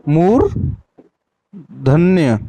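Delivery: मूर्धन्य stretched out very slowly, syllable by syllable; drawn-out demo. Shown as a demonstration. Teacher drills the class, drawing मूर्धन्य out very slowly. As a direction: draw मूर्धन्य out very slowly for the students. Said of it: "क्या बोलेंगे?".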